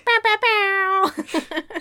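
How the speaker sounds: loud, high, drawn-out horn blasts; imitated air horn. Imitates air horn after joke.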